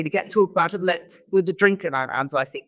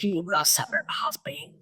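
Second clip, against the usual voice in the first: put-on light Dutch accent, whispered; imitating a Dutch executive's accent; 6 dB quieter than usual. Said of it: "I'm trying to Dutch accent".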